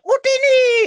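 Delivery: shrieked high and loud, cartoonish; mock battle cry. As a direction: yell it like a zapping Jawa. Jawas zapping R2, acted shout.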